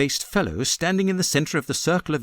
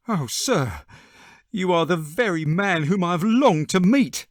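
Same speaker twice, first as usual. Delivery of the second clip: higher, eager and grateful; eager cry; 6 semitones higher than usual. A narrator's reading. Little man crying out to Holmes in eager relief. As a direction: cry it out like a man who found his saviour. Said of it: "cried the little fellow".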